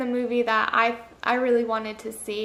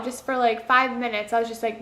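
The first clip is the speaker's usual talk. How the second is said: ordinary talk, nothing marked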